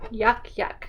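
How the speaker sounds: ordinary delivery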